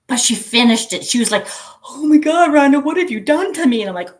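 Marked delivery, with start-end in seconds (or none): 1.8-3.8 loud, high mock wail of exasperation; exasperated friend's cry. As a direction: cry out at her like a worn-out friend. Friend exclaiming at the hard canvas.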